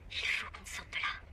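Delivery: slow, breathy urgent whisper. Acted. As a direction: whisper her worry. Worried whisper during visit.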